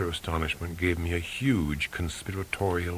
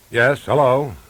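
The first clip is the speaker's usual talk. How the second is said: loud, higher, harried phone greeting; impatient, harried; 5 semitones higher, 11 dB louder than usual. A narrator's reading. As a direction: answer loudly like you've been interrupted. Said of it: "in an impatient and harried voice".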